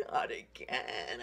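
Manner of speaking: low, slow groaning sigh of a woken bear; a big bear's weary sigh and complaint; grumpy old bear. Acted. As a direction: drag it out like a sleepy grumbling bear.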